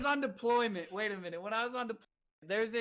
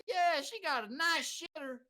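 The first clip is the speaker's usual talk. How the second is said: put-on slow, higher-pitched Mississippi accent; stretched Mississippi twang; Mississippi drawl; 7 semitones higher, slower than usual. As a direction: imitate a Mississippi soldier, drawn out. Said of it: "Mississippi National Guard soldier there".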